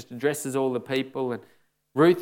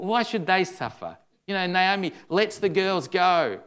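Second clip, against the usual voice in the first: ordinary talk, nothing marked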